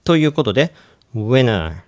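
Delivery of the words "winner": "winter" said as "winner", no T at all; T dropped. Shown as a demonstration. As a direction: drop the T so "winter" sounds like "winner". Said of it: "パターンの三つ目". (Tを落として「winner」と発音)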